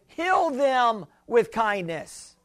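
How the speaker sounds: slow emphatic correction on "Heal"; stress on HEAL. Shown as a demonstration. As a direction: lean gently on "Heal them with kindness". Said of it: "I'd rather say it this way".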